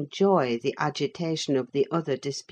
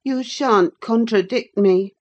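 put-on petulant boy voice; 6 semitones higher, 6 dB louder than usual. A narrator's reading. Linton arguing.

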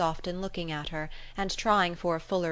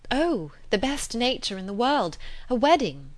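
higher, louder burst of good news; excited announcement; 5 semitones higher, 5 dB louder than usual. A narrator's reading. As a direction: announce it brightly, with a lift. Announcing happy news of a wedding.

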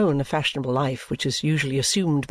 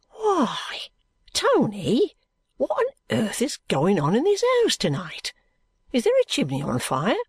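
startled, raised voice of alarm; Guppy alarmed by the soot; aghast; 8 semitones higher than usual. Acted.

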